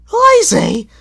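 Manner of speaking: very high, loud fuming Lazy; indignant huff. A narrator's reading. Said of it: "the coaches fumed".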